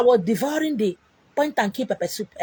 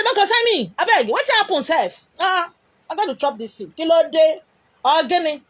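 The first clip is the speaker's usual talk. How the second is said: excited insisting; 6 semitones higher than usual. Retold or imitated. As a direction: brush off the worry, raised and keen. Retold craving, insisting to friend.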